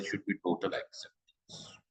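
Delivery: softly whispered conclusion. Real, not acted. Teacher whispering the key point to the class. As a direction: hush it, as a secret truth.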